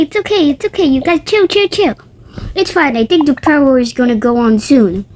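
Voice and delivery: usual speaking voice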